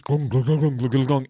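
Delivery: rhythmic gulping "glung" noises; bouncy mouth sound of a heavy body lolloping; imitated lumbering noise. Describing seals on the beach, imitated lumbering noise.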